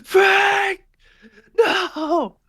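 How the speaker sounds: high, loud cry of the name; anguished yell. Acted. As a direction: yell out in horror for a fallen partner. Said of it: "his partner just yells out".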